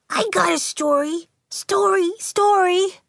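put-on high, small girlish story voice; soft little voice. A narrator's reading. Shy Jocelyn offering her story, small soft voice. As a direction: pitch it well up, timid and small. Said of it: "she said softly".

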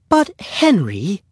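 high, slow motherly objection; protesting mother. A narrator's reading. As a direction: plead with your son, pitched high. Mother objecting to her son.

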